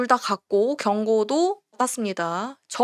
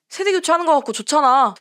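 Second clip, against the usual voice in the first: normal talking voice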